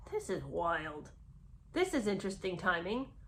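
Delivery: low, soft aside to oneself; understated, dubious undertone; private sceptical thought. Acted. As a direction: drop the voice for a mock inner remark.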